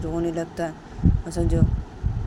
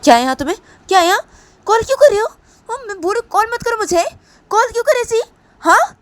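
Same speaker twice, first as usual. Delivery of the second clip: put-on much higher, much louder angry yelling girl; furious yelling; 13 dB louder, faster than usual. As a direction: scream at him for calling. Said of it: "एकदम से ही संजू को बोलती है".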